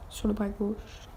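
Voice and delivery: répété doucement, ralenti; plus doux. Shown as a demonstration. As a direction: reprendre tout bas pour se corriger. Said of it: "Je me suis pas trompée". (confirmation à mi-voix)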